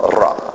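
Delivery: Ra with a trilled R; rolled R. Shown as a demonstration. Preacher showing the congregation how to roll the R. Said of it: "You just roll your R".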